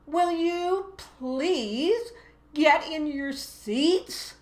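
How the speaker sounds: high, very slow, pleading whine; whiny teacher voice. Shown as a demonstration. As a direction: beg the class to sit like a helpless child. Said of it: "That really is the child voice".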